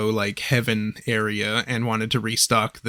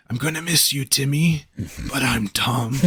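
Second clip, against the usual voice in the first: dying Tom voice; 3 dB quieter than usual. Retold or imitated. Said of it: "on his deathbed, he's just like".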